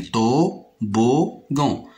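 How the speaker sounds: "Toboggan" very slow, in three separate syllables. Shown as a demonstration. Teacher pointing out first and second syllables, "Toboggan" slowly split. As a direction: break "Toboggan" into to, bo, ggan. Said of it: "في المقطع الأول وفي المقطع الثاني".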